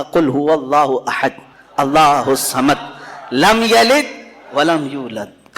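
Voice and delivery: slow reverent recitation; Quran recitation. Acted. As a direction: intone the verse slowly.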